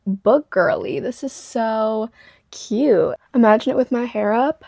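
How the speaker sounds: ordinary talk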